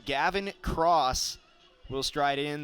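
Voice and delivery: ordinary talk, nothing marked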